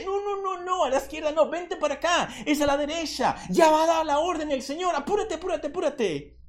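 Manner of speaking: high, hurried playful fish voices; fish urging a straggler. Pretend. Preacher voices fish warning a distracted one, high urgent pleading. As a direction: urgently call them over in a high voice. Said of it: "que todos le hacen".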